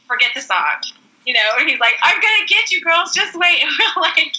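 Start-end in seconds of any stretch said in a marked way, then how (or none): 2.0-3.6 a touch higher playful threat; mock threat. Justin warning the girls, playful mock threat. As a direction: tease a revenge promise.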